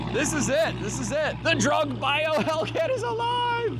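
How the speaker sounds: high, elated proclamation; triumphant announcement. Real, not acted. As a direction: declare it in triumph, stretching the last line. Proclaims the revived car alive.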